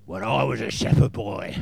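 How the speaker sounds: loud gruff booming king; gruff shout. Retold or imitated. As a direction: boom it out like a gruff warrior. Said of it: "with a sort of monotone, gruff voice".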